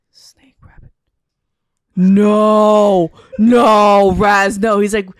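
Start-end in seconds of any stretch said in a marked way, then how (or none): 0.1-0.9 whispered guess. Retold or imitated. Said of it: "And I'm like". 2.0-4.8 loud pleading protest. Retold or imitated. Retold protest to Raz.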